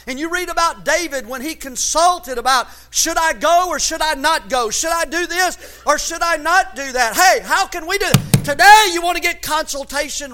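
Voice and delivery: loud, high-pitched pulpit cry; impassioned preaching. Real, not acted. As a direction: drive each phrase home to the congregation. Preacher builds to full force.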